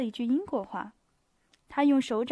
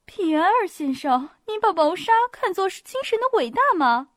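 high, loud incredulous question; incredulous smiling challenge; 7 semitones higher, 7 dB louder than usual. A narrator's reading. Little princess questioning Pierre, incredulous. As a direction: challenge him with a light smile. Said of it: "她一面微微发笑".

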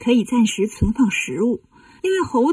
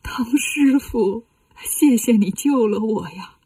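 slow, whispery acted weeping; tearful thanks; slower than usual. Revived king thanks Tang monk for saving him, tearful. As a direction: sob out the gratitude slowly. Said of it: "一看见唐僧就哭了".

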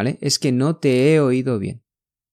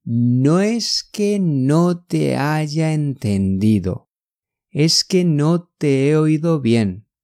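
very slow, drawn-out syllables; unhurried teaching pace; slow demonstration; slower than usual. Shown as a demonstration. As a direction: take it word by word for learners. Slow contrast take for learners.

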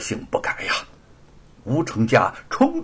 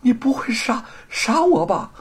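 high, halting, stammered fear; trembling terror; 11 semitones higher than usual. A narrator's reading. Thief with a sword at the throat, trembling terror. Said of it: "不由得惊恐起来，浑身颤抖着".

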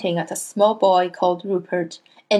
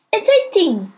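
much higher anxious cry; cried out; 11 semitones higher, 4 dB louder than usual. A narrator's reading. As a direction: hurry to correct himself. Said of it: "he cried".

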